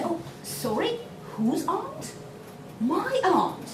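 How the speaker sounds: "whose aunt" rising high, then "My" stressed high; a querying "whose aunt", answered with emphasis on "My"; emphatic stress. Shown as a demonstration. Teacher modelling a conversational check, emphatic stress on "My". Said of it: "conversational pattern where they say".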